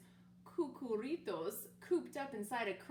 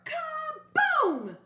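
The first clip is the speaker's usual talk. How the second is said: much higher, very loud blast; holler; 14 dB louder than usual. Acted. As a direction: explode the word like a crash. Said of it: "he hollered".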